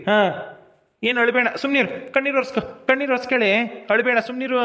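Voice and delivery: high-pitched, insistent hushing; pleading reassurance, pitched well up; urgent consoling. Acted. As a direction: hush a crying sister. Brother hushing his crying sister.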